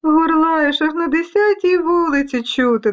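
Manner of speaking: high, taunting little voices; high-pitched storybook teasing; teasing dust specks. Acted. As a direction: taunt the big bully. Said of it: "дражнилися пилинки".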